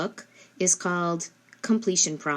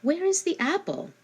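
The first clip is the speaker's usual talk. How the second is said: much higher, lilting question; asking the child; 11 semitones higher than usual. Shown as a demonstration. Parent quizzing child on prepositions, much higher question. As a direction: sing the question up to a small child. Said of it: "ask them".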